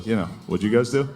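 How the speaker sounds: normal talking voice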